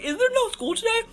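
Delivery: high-pitched disbelieving question; high mock alarm. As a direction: ask it high, as if only just realizing. Acted realisation of being locked out.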